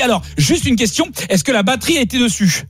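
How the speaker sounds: imitating a breezy salesman on the phone; high-pitched salesman. Retelling the Décathlon salesman.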